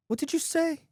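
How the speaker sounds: high, confused questioning cry; confused yell. A narrator's reading. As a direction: call it out high in disbelief. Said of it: "Confused, the man yells".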